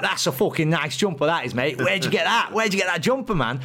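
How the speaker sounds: excited Manchester rock star accosting a stranger. Retold or imitated. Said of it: "He's like".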